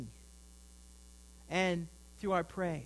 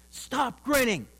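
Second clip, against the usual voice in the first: higher, louder mock scolding; loud stage-whisper scolding; 5 semitones higher, 7 dB louder, slower than usual. Mother scolds her smiling child in church, loud mock reprimand. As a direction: scold like a mother everybody can overhear. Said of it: "in a stage whisper that everybody could hear".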